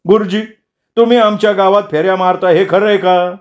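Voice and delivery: high-pitched interrogator voice; questioning villager. Acted. Villager steps forward to question the teacher about his rounds, high probing voice.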